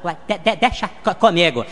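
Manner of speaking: slow stammer on each syllable; stuttering Gaguinho. Acted. Friend on the phone stammers a greeting. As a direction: trip over the first syllable, then push through. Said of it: "O Gaguinho".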